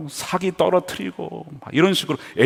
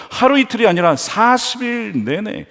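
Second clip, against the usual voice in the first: ordinary talk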